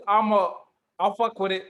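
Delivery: ordinary talk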